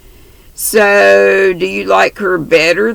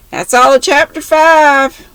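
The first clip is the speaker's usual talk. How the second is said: cheerful; 9 semitones higher, 5 dB louder than usual. Real, not acted. Reader ends the chapter.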